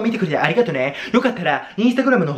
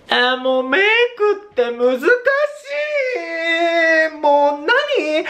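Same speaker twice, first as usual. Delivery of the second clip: high, slow drawn-out whine; whiny lament; 11 semitones higher, slower than usual. Real, not acted. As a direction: moan in exaggerated despair. Makeup looks bad in photos.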